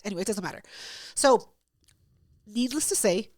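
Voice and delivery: ordinary delivery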